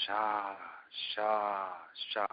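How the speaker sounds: low, drawn-out intoned syllable; guru giving mantra. Retold or imitated. Guru leaning over to give a student the mantra, intoned. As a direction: intone it slowly, leaving space between. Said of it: "he leans over, and he goes".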